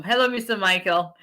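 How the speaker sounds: ordinary delivery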